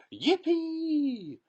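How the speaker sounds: very high, loud whoop; joyful shout. A narrator's reading. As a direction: whoop with pure joy. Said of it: "shouted Grandpa Joe".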